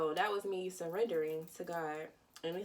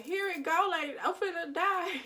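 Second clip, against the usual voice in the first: higher, louder laughing panic; panicked cry; 11 semitones higher, 7 dB louder than usual. Retold or imitated. On the bridge, sure she'll die, laughing panic. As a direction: cry it out half-laughing in fright. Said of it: "I'm like".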